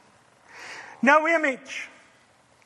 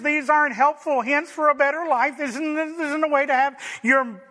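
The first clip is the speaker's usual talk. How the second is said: ordinary talk, nothing marked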